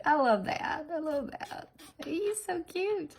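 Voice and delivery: high, climbing gush; adoring coo. Real, not acted. Nail artist delighted by the cute little guy, adoring coo. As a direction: coo over something tiny and cute.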